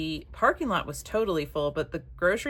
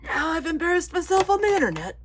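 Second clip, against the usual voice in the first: high, louder silly put-on voice; strange made-up voice; 12 semitones higher, 5 dB louder than usual. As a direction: push it up into a daft voice, mock embarrassed. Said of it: "makes up strange voices".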